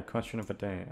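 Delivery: usual speaking voice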